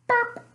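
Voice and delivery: little burp. Acted. Hungry bear's rumbling ends, short high burp. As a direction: pop out a cartoon burp. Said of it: "The rumbling grew softer and softer until".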